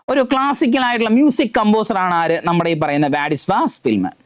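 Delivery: normal talking voice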